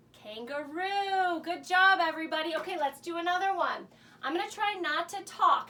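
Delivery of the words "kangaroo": usual speaking voice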